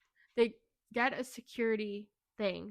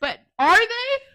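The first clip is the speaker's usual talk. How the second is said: slow, much higher and louder challenge; emphatic question; 12 semitones higher, 14 dB louder, slower than usual. Real, not acted. Fan insisting the couple never ended together, emphatic. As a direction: hit it hard, then drop to the answer. Rhetorical emphasis on book twist.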